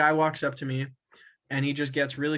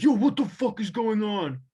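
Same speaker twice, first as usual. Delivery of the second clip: higher, louder mock bouncer yell; 6 semitones higher, 4 dB louder than usual. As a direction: storm in demanding answers, mock-tough. Bouncer storms out, retold.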